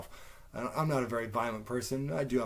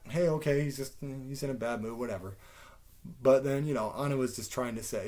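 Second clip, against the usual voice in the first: usual speaking voice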